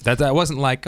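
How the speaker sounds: normal talking voice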